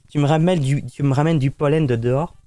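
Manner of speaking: usual speaking voice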